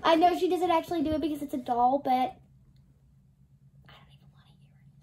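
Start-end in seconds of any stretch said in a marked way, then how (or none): 2.1-5.0 whispering. Real, not acted. Whispered aside.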